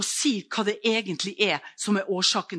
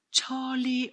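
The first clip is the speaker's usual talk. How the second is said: a raised shout for Charlie; calling out; 6 semitones higher than usual. Retold or imitated. Woman after her fall calls out to her dog Charlie. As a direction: call the dog's name, pitched high.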